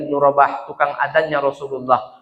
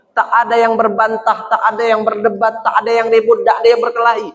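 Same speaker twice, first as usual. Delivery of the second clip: rising sermon praise; 7 semitones higher than usual. Acted. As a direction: build up the wonder for the congregation. Preaching in praise of Medina. (ceramah penuh kekaguman)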